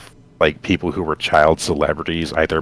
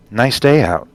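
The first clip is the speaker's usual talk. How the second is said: story greeting; 6 semitones higher, 3 dB louder than usual. Acted. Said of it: "she said as a greeting".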